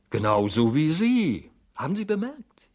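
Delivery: high, astonished emphasis; wide-eyed amazement. A narrator's reading. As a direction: marvel at the exact likeness. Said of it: "riss die Augen immer weiter auf".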